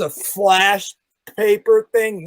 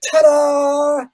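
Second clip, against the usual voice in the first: higher, bright mock flourish; showman ta-da; 6 semitones higher than usual. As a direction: sell it like a magician's finale. Stepping out after the burn.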